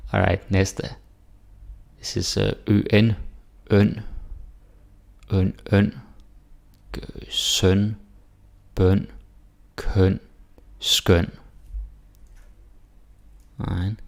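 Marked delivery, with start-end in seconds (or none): 2.7-11.3 "skøn" with rounded front ø like German ö; teacher drilling "øn, bøn, køn, skøn" with Danish ø; Danish ø sounds. Shown as a demonstration. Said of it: "here we got Ø S T".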